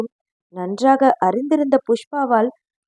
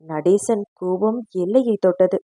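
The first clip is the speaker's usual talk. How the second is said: ordinary delivery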